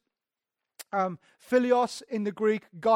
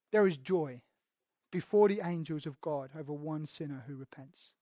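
solemn reading; 6 semitones lower, 6 dB quieter than usual. Acted. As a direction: lower and softer scripture reading.

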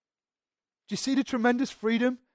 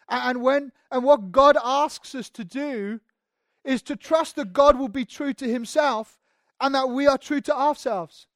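louder, higher, rising preacher's push; impassioned preaching; 7 semitones higher, 11 dB louder than usual. Acted. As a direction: drive it home from the pulpit. Preaching at full force.

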